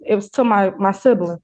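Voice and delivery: ordinary delivery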